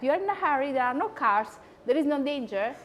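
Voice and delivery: ordinary talk, nothing marked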